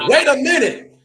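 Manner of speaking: high, loud mock warning shout; imitated sudden outburst. Sister turning on her attacker in the test, imitated loud outburst. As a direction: burst out high and loud, suddenly someone different. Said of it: "she turned into somebody different".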